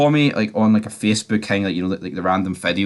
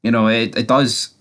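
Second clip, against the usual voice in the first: ordinary talk, nothing marked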